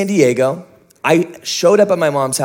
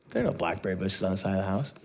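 much lower and softer, quick flat correction; mother flatly telling her son he is wrong, low and quiet; mom's deadpan correction; 9 semitones lower, 13 dB quieter, much faster than usual. Retold or imitated. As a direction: say it low and dry, like a mother seeing the damage. Mom flatly correcting him.

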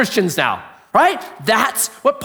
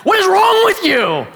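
exasperated shout; 12 semitones higher, 4 dB louder than usual. Retold or imitated. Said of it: "You're like".

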